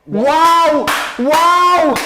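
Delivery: two high, loud wows; amazed cheering. Real, not acted. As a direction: belt out each wow in awe. Amazed fan reacting to the goal.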